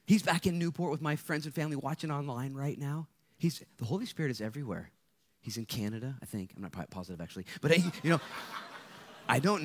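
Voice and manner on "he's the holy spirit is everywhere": normal talking voice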